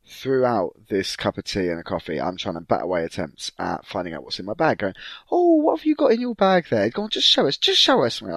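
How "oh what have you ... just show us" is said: very high, loud wheedling; pestering grandparent. Retold or imitated. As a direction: nag to see the bag.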